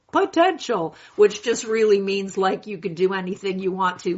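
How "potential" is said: potential pitched up and mocking; scornful quote. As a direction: sneer the word potential. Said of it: "I hate this word".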